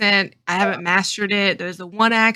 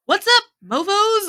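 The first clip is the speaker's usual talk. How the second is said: high, drawn-out goofy hello; mock party-crasher greeting; scatterbrained greeting; 13 semitones higher, 4 dB louder than usual. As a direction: holler a silly greeting, pitched way up. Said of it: "it's just a weird scattered brain".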